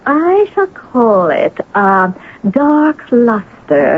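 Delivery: slow, savoured naming of the title; grand slow announcement. Acted. Wife announcing her novel's title to John, slow and grand. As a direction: draw the title out like a proclamation.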